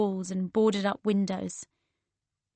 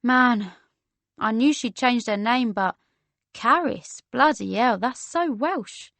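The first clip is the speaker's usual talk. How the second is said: amused, incredulous London voice; chatty London woman; 2 semitones higher than usual. Acted. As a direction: rib a friend's name in a London accent.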